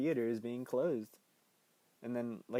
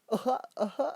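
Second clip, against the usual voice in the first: high, loud imitated clown laugh; Pennywise; 12 semitones higher, 5 dB louder than usual. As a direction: cackle at your own bad joke. Pennywise laughs at own joke.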